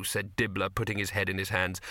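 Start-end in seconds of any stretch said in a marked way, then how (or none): none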